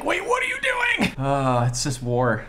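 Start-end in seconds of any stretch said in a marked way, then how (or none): 0.0-1.1 high-pitched mock panicked shout; Yelena. Reactor voicing a character's alarm at the battle, mock shriek. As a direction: shriek it as an exaggerated imitation of alarm. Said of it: "Yelena's like".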